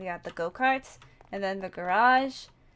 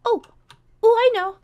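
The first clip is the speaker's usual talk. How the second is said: a much higher, much louder burst of discovery; excited realisation; 13 semitones higher, 13 dB louder than usual. Acted. Bored kid suddenly thinking of a plan, excited burst. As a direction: blurt it out as the idea hits. Bored character gets an idea.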